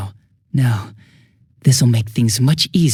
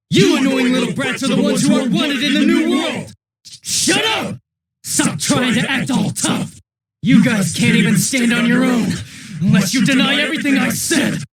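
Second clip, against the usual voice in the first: screamed much higher, frantic and unhinged; furious screaming rage; 11 semitones higher than usual. Acted. Cornered killer screaming at the teenagers, unhinged fury. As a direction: lose it completely, shrieking at the brats. Villain raging at the teens in fight.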